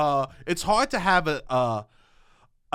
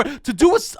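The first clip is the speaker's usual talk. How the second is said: ordinary talk, nothing marked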